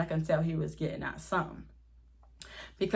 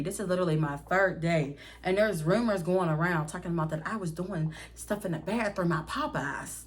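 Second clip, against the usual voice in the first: ordinary talk, nothing marked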